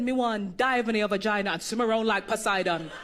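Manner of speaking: low Jamaican patois come-on; deep Jamaican patois pickup line; Jamaican man's line. Retold or imitated. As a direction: croon it like a smooth Jamaican charmer. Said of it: "This man walked up to this woman and said".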